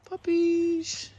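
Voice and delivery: high, loud happy shout; a real excited yell for the puppies; excited call. Real, not acted. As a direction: call them out with glee. Calling the puppies out.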